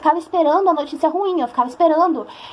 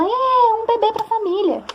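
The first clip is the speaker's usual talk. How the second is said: high excited cheer, imitating the relatives; family cheering; 10 semitones higher, slower than usual. Relatives cheering the pregnancy news, high excited cheer. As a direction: mimic the whole family cheering. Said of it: "tudo gritando".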